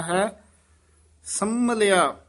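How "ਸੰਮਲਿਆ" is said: ਸੰਮਲਿਆ with a long pressed m; weighty doubled m in ਸੰਮਲਿਆ; tight, heavy m. Shown as a demonstration. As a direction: say ਸੰਮਲਿਆ firmly, "sam-malia". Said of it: "ਥੋੜਾ ਜਿਹਾ ਕਸ ਕੇ ਬੋਲਣਾ ਹੈ".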